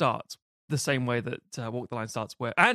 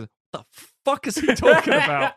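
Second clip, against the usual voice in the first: baffled outburst; 11 semitones higher, 7 dB louder than usual. Retold or imitated. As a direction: burst out baffled and laughing. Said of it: "he walks away".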